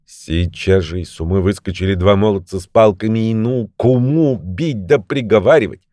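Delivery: plain reading voice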